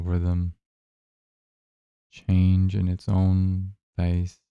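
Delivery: unhurried, even-toned guidance, leaving space between phrases; guided meditation. Acted. Guide asking listeners to notice their breath, calm guided meditation.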